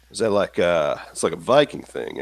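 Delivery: ordinary delivery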